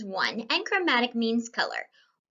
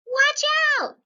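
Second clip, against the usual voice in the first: high, loud warning cry; sudden panicked call of watch out; alarmed shout; 6 dB louder than usual. Acted. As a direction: yell it as you fall. Falling into the page.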